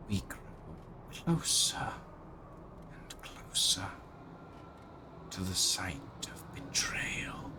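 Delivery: whispering armor. Acted. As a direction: ghostly whisper from living armor. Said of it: "you hear the armor".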